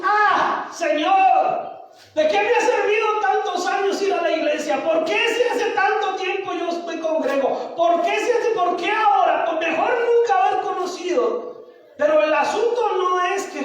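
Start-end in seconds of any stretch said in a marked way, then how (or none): 0.0-11.5 higher-pitched acted wail of protest; frustrated complaint. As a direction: complain to God like a let-down churchgoer. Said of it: "esa queja de Josué". (queja frustrada, en tono agudo)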